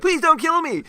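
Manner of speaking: high, loud hammed-up begging; squeaky panicked plea; mock pleading. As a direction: beg for your life, overdone. Mock pleading prisoner quote.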